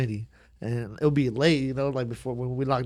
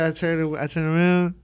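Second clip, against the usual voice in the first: ordinary talk, nothing marked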